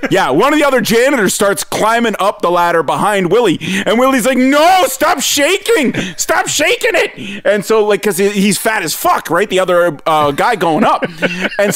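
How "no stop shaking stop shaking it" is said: high, slow drawn-out protest; imitated frightened holler; mock panicked plea. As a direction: plead from a wobbly ladder. Frightened man on shaken ladder, retold.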